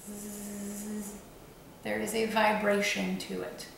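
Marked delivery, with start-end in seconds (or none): 0.1-1.2 soft low buzzing hum; low bug zapper buzz. Shown as a demonstration. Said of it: "bug zapper sound at a very low frequency".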